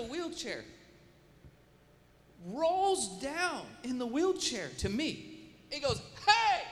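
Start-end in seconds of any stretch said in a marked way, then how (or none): 6.3-6.6 imitated disgruntled yell, high and loud; angry yell. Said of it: "Yelling at me like mad".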